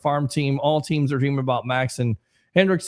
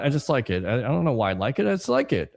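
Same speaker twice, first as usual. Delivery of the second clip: ordinary delivery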